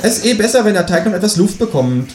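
a cheeky kangaroo asking for eggs. Acted. Kangaroo voiced in read story. (das Känguru bittet um Eier, mit hoher Stimme)

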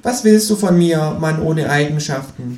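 high, sharp kangaroo voice; cheeky high kangaroo. A narrator's reading. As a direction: pitch the kangaroo up and sassy.

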